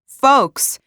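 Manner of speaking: high, loud call for attention; shouting over din. A narrator's reading. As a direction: call the room to order. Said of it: "she shouted over the din".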